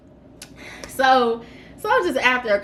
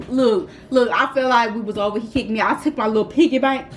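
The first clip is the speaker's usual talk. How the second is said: normal talking voice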